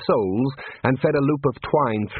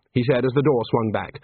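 plain reading voice

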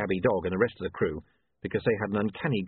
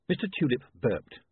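ordinary delivery, nothing marked

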